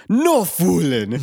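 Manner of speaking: high, loud, drawn-out cartoon Scottish; Scottish impression. Scotsman from The Simpsons greeting visitors, hammed-up Scottish. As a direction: burst out in hammy Scottish. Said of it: "that's a really Scottish phrase".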